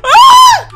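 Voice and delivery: very high, loud shriek; panicked scream. Real, not acted. Attacked in the game.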